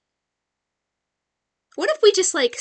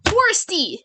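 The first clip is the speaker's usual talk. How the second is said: raised, higher call for service; 7 semitones higher, 5 dB louder than usual. Acted. Customer hails the bartender at the saloon, calling out. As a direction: call across a bar to the bartender.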